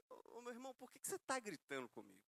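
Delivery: softly retold complaint, well below the heat of the original; toned-down retelling. Husband challenges the man on the phone, toned down for the congregation. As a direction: retell the telling-off in a muted voice. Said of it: "eu não falei nessa tonalidade".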